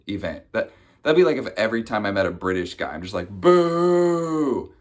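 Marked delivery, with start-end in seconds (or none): 3.3-4.7 long, loud, high-pitched boo; mock jeering boo. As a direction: heckle with a hammed-up drawn-out hoot. Acted jeering at British guy.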